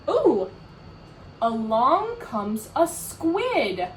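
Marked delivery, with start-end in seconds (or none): 0.1-0.5 sharp gasping ooh, higher and louder; surprised gasp. Acted. Reader to children, gasping in surprise. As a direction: gasp as if the page just turned.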